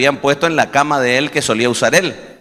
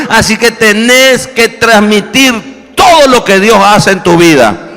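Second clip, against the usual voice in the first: higher and louder, pressing every phrase; fervent preaching; 7 semitones higher, 7 dB louder than usual. Acted. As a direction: preach it to the congregation with urgency. Preaching on testimony, raised voice.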